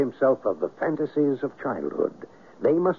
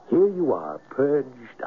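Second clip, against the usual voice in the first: ordinary talk, nothing marked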